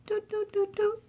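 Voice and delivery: high, soft sing-song doots; feeding sound. Acted. Sprinkling food for Otto, high soft doots. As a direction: toot the feeding noise up high.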